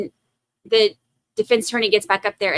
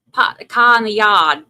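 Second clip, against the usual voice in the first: put-on licht Boston-accent, luider; 7 dB louder than usual. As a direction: zeg het als een Bostonian.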